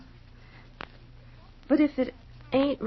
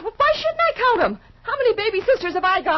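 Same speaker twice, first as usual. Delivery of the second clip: indignant outburst; 7 semitones higher, 4 dB louder, faster than usual. Acted. Sister's jealous outburst about Fay.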